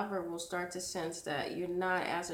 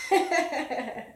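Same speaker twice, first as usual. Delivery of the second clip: laughing; 9 semitones higher, 10 dB louder than usual. Real, not acted. Laughing through word.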